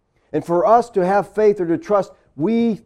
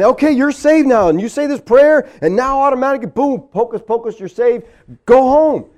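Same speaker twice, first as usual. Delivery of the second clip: higher, louder, glib mock preacher; 5 semitones higher, 5 dB louder than usual. Pastor lampooning a quick-salvation preacher, higher, louder and glib. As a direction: rattle off salvation like hocus pocus. Mocking altar call preacher.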